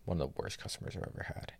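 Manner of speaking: fast whispered complaint; hushed aside. Real, not acted. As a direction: rush it out under your breath. Complaining about awful customer.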